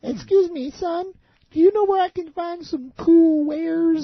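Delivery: put-on high, slow old lady voice; quavery granny asking for wares; mock grandma. Old lady asking a boy for yarn, high and slow.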